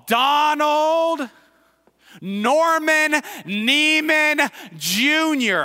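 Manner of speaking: high, slow full-name call. Shown as a demonstration. Preacher singling out a listener by full name, calling out. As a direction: call each name out long and ringing. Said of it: "I just called it out for everybody".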